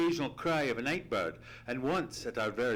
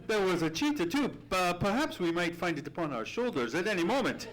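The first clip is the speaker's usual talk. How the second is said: alarmed; 9 semitones higher, 6 dB louder than usual. Acted. Watson fearing the loose cheetah.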